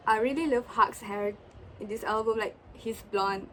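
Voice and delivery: usual speaking voice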